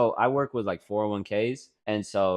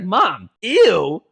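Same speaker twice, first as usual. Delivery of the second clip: laughing, loud, high scolding; 12 semitones higher, 11 dB louder, much slower than usual. Retold or imitated. Daughter laughing while scolding mom over ew. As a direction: laugh through the telling-off.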